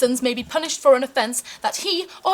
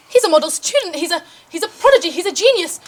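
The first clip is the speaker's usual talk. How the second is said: gushing pride; 4 semitones higher, 5 dB louder than usual. Acted. Mother defending her son.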